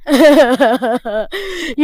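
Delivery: a big laugh from the belly; hearty laugh. Acted. Husam laughing with friends about being hungry, a hearty laugh.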